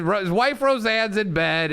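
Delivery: ordinary talk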